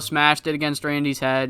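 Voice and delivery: ordinary talk, nothing marked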